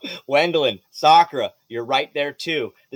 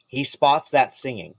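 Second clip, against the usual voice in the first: ordinary talk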